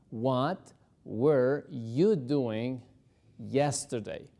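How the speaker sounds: word by word, very drawn out; slow chunked drill; slow broken drill. Shown as a demonstration. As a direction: break it apart slowly for learners. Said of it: "El verbo were lo tenemos en pasado".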